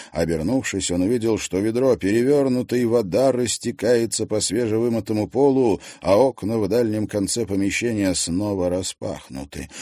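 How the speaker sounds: ordinary delivery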